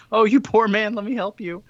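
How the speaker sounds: high-pitched, put-on pity; cooing mock concern, pitched high; feigned sympathy. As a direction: act out a fake kindly rescuer. Disguised Scrooge feigning sympathy.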